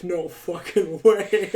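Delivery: slow, drawn-out amazed disbelief; reacting to yet another angry react. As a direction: stretch each word in shock. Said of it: "And I was like".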